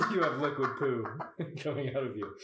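words squeezed out through giggles; giggling while talking; laughing. Real, not acted. Joking about diarrhea.

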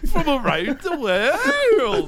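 hammed-up high child with a laugh; worldly little boy. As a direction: stretch it out in a silly unplaceable accent. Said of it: "imagine a little boy".

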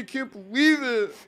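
high, loud, emotional disbelief; overwhelmed groomsman. Acted. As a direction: let happy disbelief push the voice up.

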